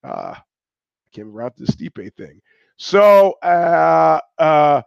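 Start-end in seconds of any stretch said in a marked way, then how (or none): 2.8-4.8 loud, high outburst; a burst of cry; sudden shout. Real, not acted. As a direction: blurt it out loud. Sudden cry mid-ramble.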